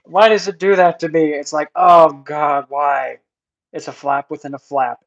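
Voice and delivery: real exasperated yelling at a stubborn box; exasperated outburst. Real, not acted. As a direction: shout it out, fed up with the flap. Frustrated by the packaging flap.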